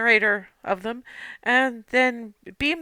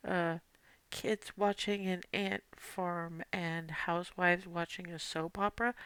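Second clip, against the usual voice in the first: softly spoken, hesitant aside; quiet; 2 semitones lower, 11 dB quieter, slower than usual. Acted. Biss hovers up to Rylan to explain, speaking quietly. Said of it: "says quietly".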